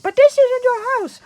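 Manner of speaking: very high, loud squawk; hammed-up high-pitched objection; mock protest. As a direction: protest in a shrill falsetto elf voice. Buddy protests in retelling.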